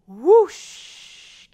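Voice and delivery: loud blowing wind whoosh. Acted. Librarian blowing the wind for the children. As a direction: blow like a gust of wind.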